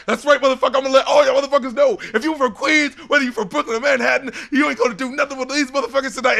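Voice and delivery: high, fast, loud hype-man taunt; hyped-up boast. Retold or imitated. Imitating the rapper firing everyone up, fast high hype call. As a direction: hype the room like a rapper starting the session.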